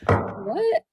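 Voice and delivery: high-pitched, baffled protest; incredulous retort. Retold or imitated. Retorts to complaining viewers.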